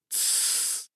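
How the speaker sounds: whispered shush; shushing hiss. A narrator's reading. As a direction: hiss them quiet. Hushing the girls.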